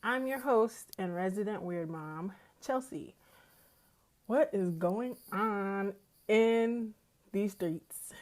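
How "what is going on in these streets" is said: drawn-out. Acted. Slow drawn greeting to listeners.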